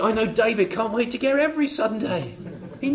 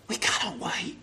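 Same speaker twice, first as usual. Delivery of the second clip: whisper; 3 semitones lower, 11 dB quieter than usual. Real, not acted. Hushed close of a preaching build.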